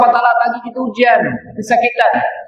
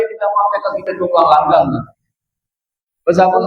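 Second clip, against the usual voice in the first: usual speaking voice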